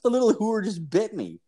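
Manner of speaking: high-pitched indignant outcry; indignant shock. Retold or imitated. Bitten man tells his buddy what happened, indignant. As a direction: protest the bite in retold outrage. Acting out complaint after bite.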